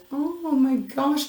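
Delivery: a slow, high, moved oh my gosh; emotional. Real, not acted. Reader moved by the querent's cards, emotional. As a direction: voice lifting as the feeling hits. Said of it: "that is making me emotional".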